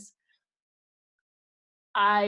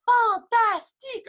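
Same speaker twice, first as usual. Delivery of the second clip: high, loud, slow fantastique; hammed-up praise; 14 semitones higher, 10 dB louder, slower than usual. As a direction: gush over a child's French like a cheer. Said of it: "I make everyone turn to them and say".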